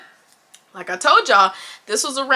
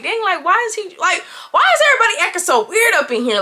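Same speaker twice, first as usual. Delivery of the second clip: puzzled inner voice; 6 semitones higher, faster than usual. Retold or imitated. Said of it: "And I'm just sitting here like".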